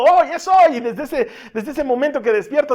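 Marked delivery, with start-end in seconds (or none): 0.0-0.8 high, loud joyful cry, laughing a little; gleeful much higher yell; excited shout. Retold or imitated. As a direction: shout it like a kid on his big day.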